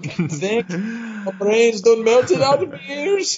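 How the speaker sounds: slow, high mock-drunk wail; drunk nanny. Nanny complaining of melted brains, in a slow mock-drunk voice. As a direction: drag it out as a tipsy nanny. Acting out drunk Missus Doubtfire.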